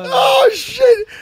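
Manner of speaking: high startled yelp of embarrassment; embarrassed shriek; startled cry. Real, not acted. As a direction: caught off guard, yelp it out. Watching own reaction, cringing.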